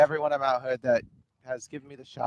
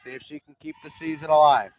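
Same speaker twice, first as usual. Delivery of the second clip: play-by-play; 3 semitones higher, 6 dB louder than usual. Acted. Live softball play-by-play.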